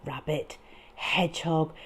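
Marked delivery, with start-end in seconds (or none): none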